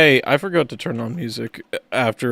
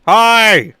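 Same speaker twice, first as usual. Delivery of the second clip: much higher, louder shouted hi; 9 semitones higher, 11 dB louder than usual. Acted. Greeting the viewers with a loud shouted hi. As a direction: belt out a big hello. Greeting chat as stream opens.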